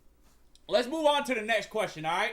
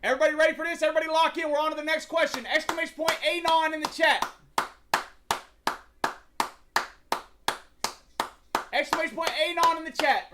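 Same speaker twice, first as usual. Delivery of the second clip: higher, louder, rallying the chat to lock in; hype call-out; 6 semitones higher, 6 dB louder, faster than usual. Real, not acted. Streamer firing up his chat, higher and louder hype. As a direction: pump up the room before the question. Hypes chat for next question.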